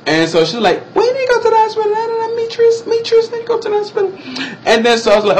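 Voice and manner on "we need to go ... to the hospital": very high falsetto woman's voice; worried auntie. Retold or imitated. Auntie finds him choking by the road, high fretting.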